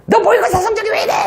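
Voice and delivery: high shrill-pitched mom scolding; nagging mom. Retold or imitated. Teacher voicing a mother scolding about grades. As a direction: nag like an angry mother.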